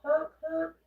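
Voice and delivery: high, loud honking goose impression; a silly high goose honk, loud. Shown as a demonstration. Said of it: "Can we get a nice honk, please?".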